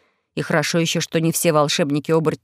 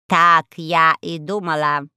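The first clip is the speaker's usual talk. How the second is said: raspy cawing crow; 2 semitones lower, slower than usual. Acted. Crow character voice.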